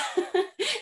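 a bright, high little laugh at herself; short laugh. Real, not acted. Panellist laughing at admitting she avoids Welsh, short high laugh. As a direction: laugh off the embarrassment lightly. Laughs at her own embarrassment.

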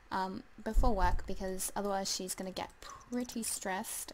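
ordinary talk, nothing marked

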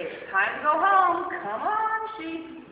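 loud, high call to the flock; shepherd calling. Acted. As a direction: call them home. Shepherd calling sheep home.

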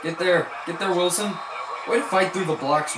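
high, loud calls to the player; real sports excitement; excited urging. Real, not acted. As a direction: yell him on through the blocks. Urging the defender to the tackle.

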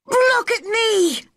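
very high, drawn-out shout; boy's defiant shout. A narrator's reading. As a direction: demand attention as a furious boy. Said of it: "he shouted at Ben".

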